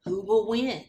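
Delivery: ordinary delivery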